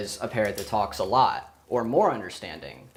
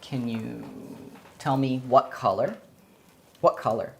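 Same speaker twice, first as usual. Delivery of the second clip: talking to a pet. Real, not acted. Training a parrot to say colours.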